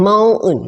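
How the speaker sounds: "mountain" said as "mow-un", T dropped; American "mountain" with no T sound; silent T. Shown as a demonstration. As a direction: drop the T in "mountain", American style. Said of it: "เขาจะออกเป็น mountain".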